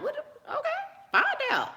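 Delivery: high, laughing mock-scared squeal; mock panic. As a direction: squeal it like a frightened girl, laughing. Mocking fear of being found out.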